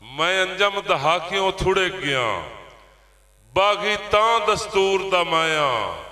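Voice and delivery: measured declamation with drawn-out rhymes; poetry recital. Acted. Poet presents himself as the labourer's voice, declaimed verse. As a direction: declaim each line, then let it fall away.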